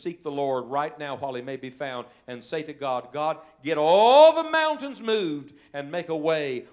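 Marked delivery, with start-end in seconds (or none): 3.1-6.7 slow, loud, rising plea; loud prayer cry. Acted. Preacher crying out to God for mountains moved, slow and loud.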